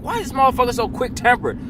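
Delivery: asking in mock disbelief; high exasperated question; incredulous complaint. Imagined friend's complaint.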